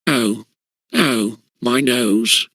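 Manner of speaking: slow, drawn-out moan over a sore nose. A narrator's reading. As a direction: let it sag out slowly, nursing the nose. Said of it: "moaned Mouldy".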